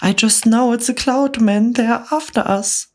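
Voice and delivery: high, panicky rise; frightened cry. Acted. Miss Spider panicking about cloud men, high and frightened. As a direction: she is sure they're doomed. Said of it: "Miss Spider cried".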